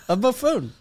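high, relishing mock insult on buffoon; mock grand pronouncement. Real, not acted. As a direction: savour the insult like a title. Mock upgrading peasant to buffoon.

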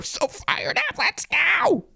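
very high, strained hype cry; real high-pitched fan yelling; fired-up yell. Real, not acted. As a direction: let it fly, sky-high and stretched out. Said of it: "I can yell all I want".

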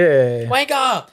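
mock British wanker. Friend echoing the UK chant in a mock British accent. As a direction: toss out "Wanker" like a UK terrace fan.

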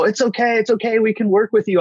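mock soothing receptionist, high and light; high, sing-song comforting voice; soothing staff. Dentist staff calming a crying patient, high and soothing. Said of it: "And they go".

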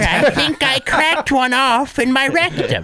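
high, slow horny granny; Seabass's grandma. Acted. Host as grandmother ordering her grandson, slow granny voice. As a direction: draw it out like a lewd old woman. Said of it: "Seabass's horny grandmother voice".

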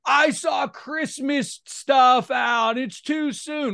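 high, drawn-out wail of outrage; hammed-up complainer's whine; mock complaining. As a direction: imitate an appalled holiday grump. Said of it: "What I can't take is the perennial complainers".

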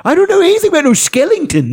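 high, fast country British impression; quick squeaky rural English voice; Nick Frost country accent. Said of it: "Nick Frost was".

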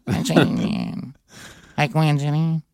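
lightly voiced computer line judge. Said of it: "computer voice".

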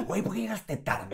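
host playfully scolding late guest; mock angry scolding. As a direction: pretend to be mad at a late guest, laughing. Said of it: "estaría bueno que me enojara".

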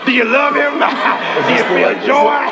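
shouting preacher. Retold or imitated. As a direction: call and response preaching. Call and response sermon.

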